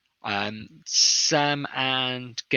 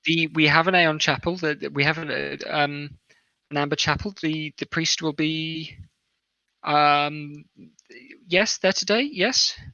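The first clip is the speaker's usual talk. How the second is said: put-on higher, halting young woman's voice; 6 semitones higher, 5 dB louder than usual. Kitchen woman answering about the priest, young woman's voice. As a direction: stumble through it as a young serving woman. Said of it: "a young woman".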